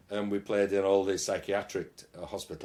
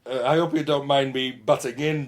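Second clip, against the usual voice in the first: comic German character voice, raised and louder; hammed-up German accent butting into the interview; 4 semitones higher, 5 dB louder than usual. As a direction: play Helmut, a jolly Bavarian bandleader, with a German accent. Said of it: "Yeah, Helmut gets in the way".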